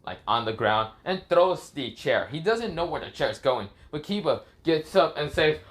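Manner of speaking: ordinary delivery, nothing marked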